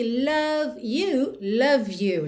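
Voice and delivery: "love you" joined with v into "you"; "love you" as "la-vyou", the e silent; linked love you. Shown as a demonstration. Teacher joining v of "love" into "you". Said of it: "o E final de love não é pronunciado".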